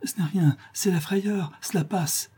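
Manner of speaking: put-on high, light girlish voice; high voice, thinning toward a whisper; young girl reassuring. A narrator's reading. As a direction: play down your fright for your mother. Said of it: "pour rassurer sa mère".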